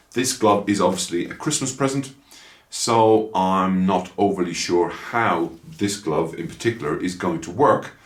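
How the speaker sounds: ordinary talk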